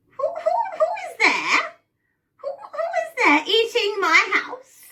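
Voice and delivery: put-on very high, wavering old woman; old lady voice. Old woman calls out to whoever is eating her house, old lady voice. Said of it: "in an old lady voice".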